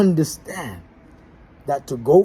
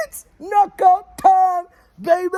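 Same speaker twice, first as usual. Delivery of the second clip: much higher, slow, stretched-out hype call; hyped catchphrase; 13 semitones higher, 4 dB louder, slower than usual. Acted. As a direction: call it out like a show opener. Host's signature sign-off catchphrase.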